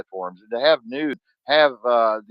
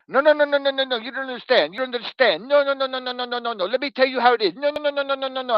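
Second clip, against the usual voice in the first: high, excited run of no's, much higher than his own voice; imitating Bruno; 13 semitones higher, faster than usual. Friend Bruno cutting him off, a high insistent imitation. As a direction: pitch way up, rattle off the no's like an insistent Frenchman. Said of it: "you'll be talking and he'll".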